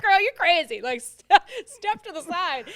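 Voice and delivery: high, loud, sassy brush-off; mock freaked-out student. Nashville classmates mock her Pittsburgh excitement, high and sassy. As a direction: shoo her away like she's crazy.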